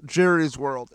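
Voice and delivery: ordinary talk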